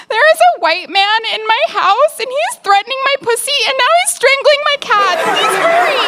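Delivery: high, loud, fast mock panic on the phone; hammed-up frantic call to the police; panicked 911 call. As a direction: shriek it to the dispatcher, hammed-up. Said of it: "I was like".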